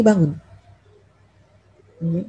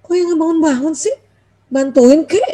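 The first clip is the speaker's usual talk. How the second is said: higher, mildly irritated nagging; annoyed; 6 semitones higher than usual. Acted. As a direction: nag your husband to help, acting peeved. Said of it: "kesel banget".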